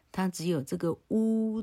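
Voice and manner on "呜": 呜 held out high and steady; name the mouth shape with a long 呜; high, sustained vowel 呜; drawn-out 呜. Shown as a demonstration. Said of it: "的嘴型哈".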